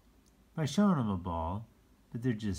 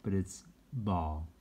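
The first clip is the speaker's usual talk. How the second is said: plain reading voice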